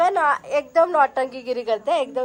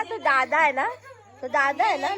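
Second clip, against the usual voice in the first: ordinary delivery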